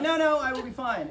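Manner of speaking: high-pitched, laughing protest; retold giddy reassurance. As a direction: insist you're fine while still giddy. Retold reassurance amid laughing fit.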